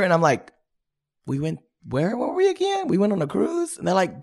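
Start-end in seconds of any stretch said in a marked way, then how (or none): 1.3-3.8 high, puzzled acted voice; hammed-up bewildered questioning; mock confusion. Acts out forgetting a trip with friends.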